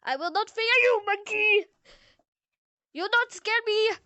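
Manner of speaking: high, loud play defiance; defiant mock shout. Defying the monkey in game.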